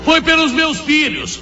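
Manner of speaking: pleading mother. Acted. Mother answering her conscience.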